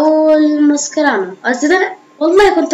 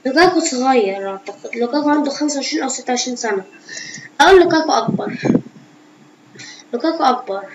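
ordinary talk

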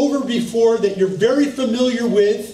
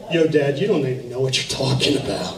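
a hint of a sulky teenage brat, lower; lightly mocking bratty teenager talking back; 7 semitones lower than usual. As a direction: mumble it back like a bratty teen. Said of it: "like a teenage brat".

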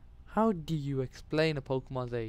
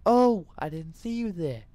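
loud, higher 'caught unawares' greeting; mock surprise; 5 semitones higher, 8 dB louder than usual. Player pretends he just noticed the viewers, mock surprised. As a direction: pretend you've just spotted the audience.

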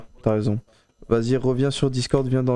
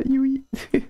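ordinary talk, nothing marked

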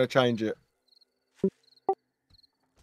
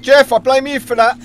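shouting; 10 semitones higher, 10 dB louder than usual. Real, not acted. Chaos of mob attack.

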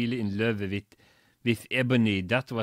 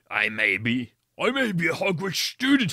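louder, defiant, hammed-up anger; angry; 4 semitones higher, 4 dB louder than usual. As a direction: push back hotly. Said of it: "Hagrid paused angrily".

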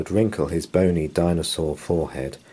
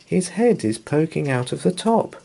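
high-pitched, playful teasing without actual laughter; amused reassurance; 9 semitones higher, 4 dB louder than usual. A narrator's reading. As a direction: tease fondly in a raised storybook voice. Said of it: "You'd laugh and say".

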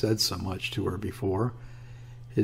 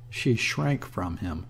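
plain reading voice

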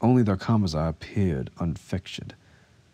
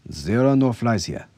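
raised, louder, controlled insistence; firm denial; 5 semitones higher, 5 dB louder than usual. A narrator's reading. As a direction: deny it firmly, stressing the words. Officer firmly denies, before shouting it.

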